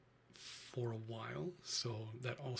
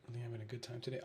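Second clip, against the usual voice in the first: normal talking voice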